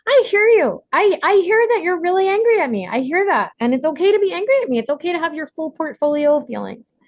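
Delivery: high, gentle reassuring mother voice; soothing mom. Retold or imitated. Mother soothing her angry son over broccoli, high gentle voice. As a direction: soothe the child but hold the line. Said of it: "I can just say to him".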